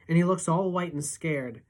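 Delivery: usual speaking voice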